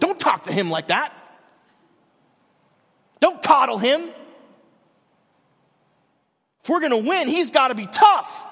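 loud, high, forceful rebuke; stern coach scolding. Retold or imitated. Coach scolding the assistant coach, loud and stern. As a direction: insist he must be tough. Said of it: "Denzel's like".

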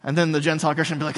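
ordinary talk

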